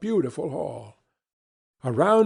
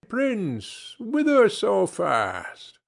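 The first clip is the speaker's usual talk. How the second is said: high, slow, sly dwarf voice drawing out the question; a little mountain imp teasing a rider; ugly little dwarf; 7 semitones higher, much slower than usual. Acted. Dwarf on the rocks calls to the hurrying prince, high and sly.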